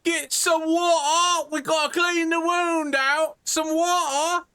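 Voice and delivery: panicked guard. Acted. GM voices panicked guard helper.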